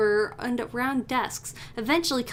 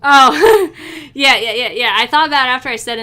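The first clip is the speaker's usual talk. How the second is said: loud laughing yeah yeah, then fast; real loud laughing, fast admission; 3 semitones higher, 14 dB louder than usual. Real, not acted. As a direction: burst out laughing at being caught. Caught out by chat's correction.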